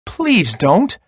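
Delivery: high little plea; pleading. A narrator's reading. Little goat begging the troll, high pleading. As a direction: beg in a little goat's high voice. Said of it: "pleaded little Billy".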